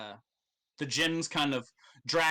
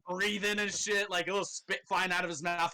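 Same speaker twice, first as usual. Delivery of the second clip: normal talking voice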